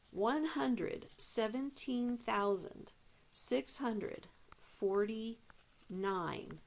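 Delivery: each number spelled out slowly; slow emphatic read. Shown as a demonstration. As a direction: lay the big number down word by word. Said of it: "It's so powerful".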